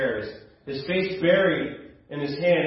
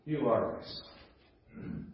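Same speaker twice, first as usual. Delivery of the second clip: slow, softer, lightly voiced tender father; tender fatherly words; 3 semitones lower, 7 dB quieter, much slower than usual. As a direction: let each word land gently, very slowly. Father figure's tender last words.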